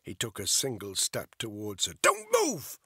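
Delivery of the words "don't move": sudden high, loud scream of a cornered woman. A narrator's reading. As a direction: shriek it at him to freeze. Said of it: "she screamed".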